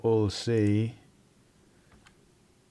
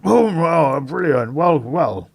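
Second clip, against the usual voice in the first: brisk, raised official voice, a bit higher; inspector's voice; 3 semitones higher, 5 dB louder than usual. Acted. Inspector briefing the players at the crime scene, brisk and official. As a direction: play the eager police inspector. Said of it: "Inspector Drummond steps forward and says".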